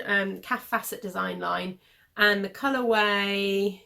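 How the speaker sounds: usual speaking voice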